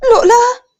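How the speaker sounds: high, loud startled cry; overjoyed cry. A narrator's reading. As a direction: call out to the found child. Sister sees the lost child. (بلهفة ومفاجأة)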